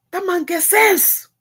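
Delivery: high, loud and slow, full of conviction; emphatic praise. Real, not acted. Vlogger insisting to her viewers that the father is wise. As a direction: lean hard into the praise.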